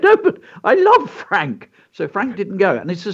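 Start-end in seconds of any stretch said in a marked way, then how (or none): 0.0-1.7 high, whiny plea to keep the trousers; husband pleading with wife to keep his old trousers, high and whiny. Retold or imitated. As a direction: squeak it out like a pleading child.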